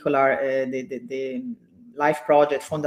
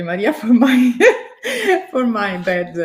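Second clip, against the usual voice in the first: laughing through the apology, pitch jumping high; embarrassed laughing apology; 8 semitones higher, 7 dB louder than usual. Real, not acted. Host laughs as she apologizes to Maria, embarrassed laughing. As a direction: giggle while saying sorry to Maria. Apologizing for her bad accent.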